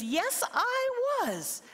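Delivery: high, thin little-creature voice; small high fairy-folk plea; wee voice. Acted. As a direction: squeak it out like a tiny fellow under the wall. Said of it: "the wee little fella says".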